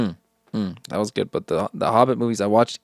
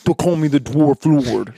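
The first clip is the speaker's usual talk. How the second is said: mock dwarf voice; 3 semitones higher, 5 dB louder than usual. Playing a dwarf.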